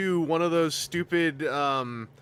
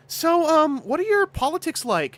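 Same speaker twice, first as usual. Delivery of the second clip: much higher, louder interviewer voice; chirpy date host; 12 semitones higher, 6 dB louder than usual. Pretend. Date asks the guy about his politics, chirpy and high. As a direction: ask brightly like a dating-show host.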